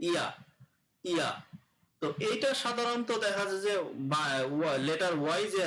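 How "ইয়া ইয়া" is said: "ইয়া" as English y, not a j; y sound demo. Shown as a demonstration. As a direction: glide into each short "ইয়া" separately. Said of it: "এটার উচ্চারণ হবে". ("ইয়া" জ নয়, য় ধ্বনি হিসেবে উচ্চারণ)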